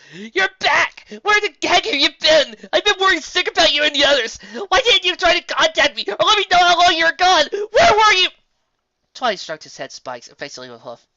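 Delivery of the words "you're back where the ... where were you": much higher, louder crying voice; sobbing relief and reproach; tearful shouting. Acted.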